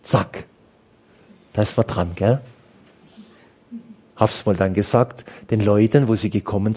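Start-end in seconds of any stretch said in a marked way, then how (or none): none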